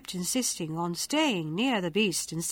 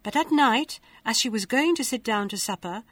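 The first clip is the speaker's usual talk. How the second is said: plain reading voice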